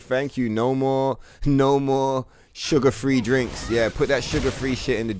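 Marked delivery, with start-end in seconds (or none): 0.0-3.7 slow, drawn-out mocking voice; mock announcement. Mocking people swearing off sugar-free drinks, slow and drawn out. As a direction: stretch it out like a grand declaration. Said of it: "motherfuckers was like".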